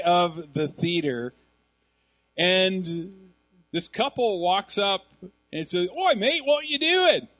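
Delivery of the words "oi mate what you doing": high, loud mock Australian greeting; Australian accent. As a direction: call out in a broad, put-on Australian accent. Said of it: "He was Australian. Could you tell?".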